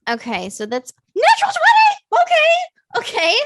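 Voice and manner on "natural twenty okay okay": shrieking laugh of joy; excited shout. Real, not acted. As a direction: scream it high and loud. Player rolls a natural twenty.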